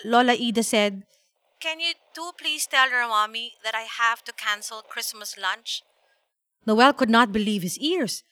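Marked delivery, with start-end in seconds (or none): 1.6-5.8 soft, apologetic granny voice; softly voiced grandmother making a request; grandmother on phone. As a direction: break bad news gently over the phone.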